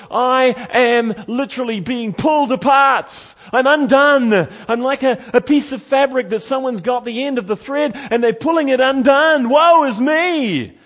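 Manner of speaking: high, loud anguished shout; Isaiah crying out. Acted. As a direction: shout as if being torn apart. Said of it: "he's literally saying this".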